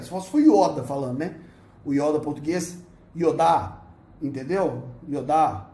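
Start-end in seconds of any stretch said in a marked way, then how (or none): 3.2-3.8 "Yodá" com acento forte na última sílaba; Yoda em português. Shown as a demonstration. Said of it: "com três Hs no final". 5.1-5.7 "Yodá" com acento forte na última sílaba; Yoda em português. Shown as a demonstration. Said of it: "com três Hs no final".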